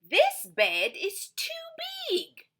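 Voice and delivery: high, slow fussy voice; storybook visitor. Acted. Storybook visitor fussing about the rain, high and slow. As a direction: stretch out the complaint.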